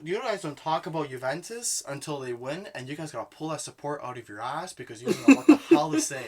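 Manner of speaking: indignant rant, hammering the repeated opening; triggered. Real, not acted. Fan scolding friends over Juventus talk, triggered. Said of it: "Sorry, [name] got triggered".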